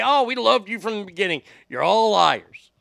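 ordinary delivery

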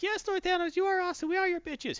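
fawning Chitauri. Retold or imitated. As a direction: fawn over your master like a servile lackey. Chitauri fawning over Thanos.

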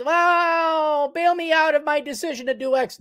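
high, wailing mock plea; hammed-up high-pitched begging; mock pleading. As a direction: imitate a helpless wail for rescue. Acting out someone begging for bailout.

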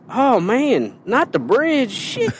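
high-pitched mimicked regional drawl; exaggerated borrowed accent; imitated local accent. Shown as a demonstration. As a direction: put on the other guy's accent, pitched high. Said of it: "So I would have been like".

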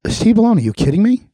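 usual speaking voice

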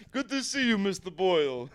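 a touch of deli counter greeting; lightly voiced deli man; deli guy. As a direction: greet a regular customer, laugh creeping in. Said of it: "He looks like a deli guy".

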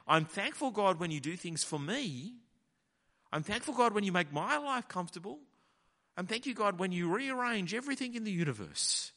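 high, loud self-satisfied prayer; mocking Jonah. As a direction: lampoon a spoiled child thanking God.

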